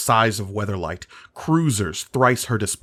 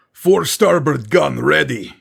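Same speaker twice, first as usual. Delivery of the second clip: loud higher gruff call; shouted; 6 semitones higher, 6 dB louder than usual. A narrator's reading. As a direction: shout across the deck. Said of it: "he shouted".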